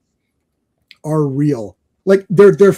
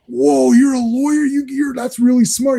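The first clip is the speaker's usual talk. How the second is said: imitating naive awe in a raised pitch; be a dude hugely impressed by a lawyer; Terry; 8 semitones higher than usual. Retold Terry awed by a lawyer.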